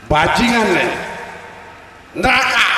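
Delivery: loud, high snarled verdict; angry condemnation. Acted. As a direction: condemn him to hell loudly. Angel of punishment condemns the man. (mengutuk dengan marah dan lantang)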